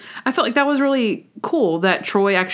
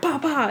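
light "bah, bah" gun noises, higher; mock gunshots; 7 semitones higher, 3 dB quieter than usual. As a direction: imitate the gunfire playfully. Mimicking gunshots in show scene.

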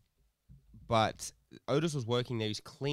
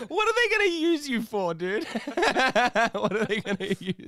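much higher, loud, laughing out the question; teasing through laughter; 11 semitones higher, 6 dB louder than usual. Real, not acted. Mocking his friend's prison prospects, laughing.

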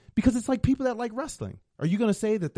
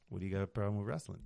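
lazy delivery; 11 semitones lower, 10 dB quieter, faster than usual. Shown as a demonstration. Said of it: "You're like this".

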